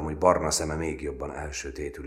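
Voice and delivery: usual speaking voice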